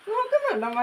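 sudden high squeaky question to a pet; pet talk. Real, not acted. Owner coaxes her dog to look at her, high pet talk. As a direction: pitch way up to coax the dog. Asking dog if it's looking.

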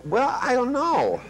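high, slow unsure reply; dithering client. Retold or imitated. Client answering a question-phrased command, high and unsure. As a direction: drag out a hesitant non-answer. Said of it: "and they go".

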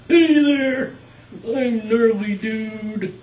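high, loud surfer-dude puppet voice; Gnarly Dude voice. Shown as a demonstration. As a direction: voice the laid-back dude puppet. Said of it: "this is Gnarly Dude".

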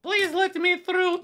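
squeaky high begging voice; high pleading voice. Acted. Voicing pleading applicant.

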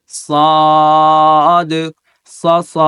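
loud, heavy Arabic সাদ; heavy sad. Shown as a demonstration. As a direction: make সাদ heavy and thick. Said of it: "দেখেন".

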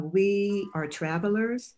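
ordinary talk

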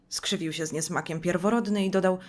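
ordinary delivery

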